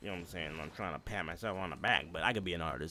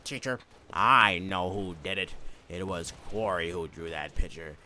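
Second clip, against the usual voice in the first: slow, lower tattletale voice; snitching classmate; 4 semitones lower than usual. Retold or imitated. Best friend telling the teacher, slow and smug. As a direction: tattle slowly and smugly. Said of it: "stands up and says".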